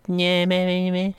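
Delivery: high, small mock child voice; little voice. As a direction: imitate a tiny creepy voice in the game. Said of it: "little beat, like, voice go".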